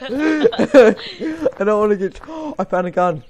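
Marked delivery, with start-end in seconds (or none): 0.0-2.2 slow, high, laughing through words. Real, not acted. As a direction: crack up mid-sentence. Laughing at himself.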